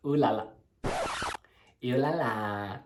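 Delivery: ordinary delivery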